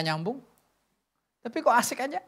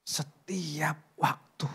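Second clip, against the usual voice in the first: slow breathy whisper of a divine promise; hushed prayerful whisper; 5 semitones lower, 6 dB quieter, much slower than usual. Acted. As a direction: whisper it slowly, reverently. Voicing God's words, hushed.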